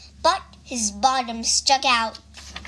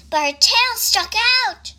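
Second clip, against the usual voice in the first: usual speaking voice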